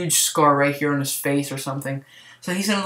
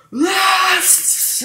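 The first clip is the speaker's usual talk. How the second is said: retold scream. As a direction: belt it out like a raging villain. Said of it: "you just hear him scream".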